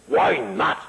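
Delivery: indignant British actor, voice leaping up; high, theatrical British protest; Cedric Hardwicke. Retold or imitated. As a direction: demand it like an affronted thespian. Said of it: "beautiful, deep voice. He had a British".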